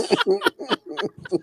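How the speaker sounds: a very high laugh. Real, not acted. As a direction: squeal with laughter at the joke. Laughing at own joke.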